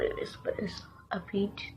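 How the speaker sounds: soft unvoiced aside; whispering. Real, not acted. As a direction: breathe it as a secret. Whispered aside about peach.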